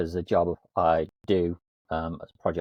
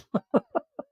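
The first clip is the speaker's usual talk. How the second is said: high giggle. Real, not acted. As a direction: burst of high-pitched giggling. Laughing at own joke.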